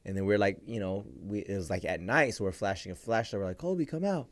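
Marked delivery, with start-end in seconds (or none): 3.6-4.3 high, gentle imitation of calling; retold calling out. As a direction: coax a hidden dog, higher. Calling hidden dog at night.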